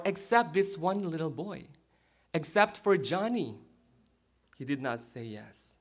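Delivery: ordinary talk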